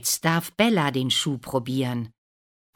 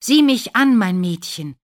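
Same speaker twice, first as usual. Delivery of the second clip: high, loud start easing down; eager call; 8 semitones higher, 11 dB louder than usual. A narrator's reading. Prince asks Lina to look up, eagerly. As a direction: urge her excitedly, then settle.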